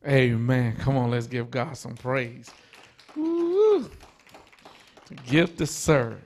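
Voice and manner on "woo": excited whoop. Real, not acted. Praising after the sermon.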